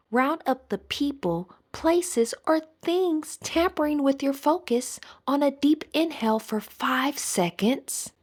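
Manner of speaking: usual speaking voice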